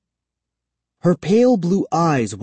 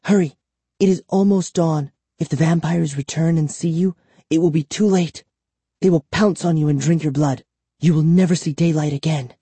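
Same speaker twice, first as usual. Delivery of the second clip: put-on high, urgent girl's warning; urgent captive girl; 6 semitones higher than usual. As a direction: hurry them along, pitched high.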